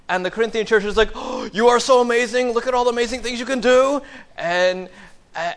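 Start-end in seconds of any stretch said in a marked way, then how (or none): 1.1-4.0 high, loud starstruck fawning; mock gushing. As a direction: gush like an adoring fan, hammed up. Fawning church voiced.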